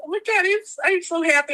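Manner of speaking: high-pitched, overjoyed retelling; shocked and happy. Retold or imitated. Guest reliving meeting her idol's ghost, shocked and happy. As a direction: gush with happy disbelief. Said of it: "I was so shocked. I was so happy".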